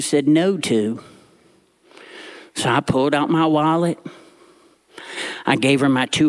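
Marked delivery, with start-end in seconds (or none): none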